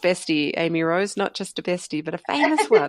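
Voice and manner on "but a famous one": much higher, playful sing-song; teasing. Real, not acted. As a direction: tease her about the famous friend. Teasing about a famous friend.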